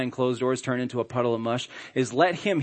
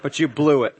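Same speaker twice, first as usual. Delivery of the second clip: mock complaint; 8 semitones higher, 7 dB louder than usual. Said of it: "I was like".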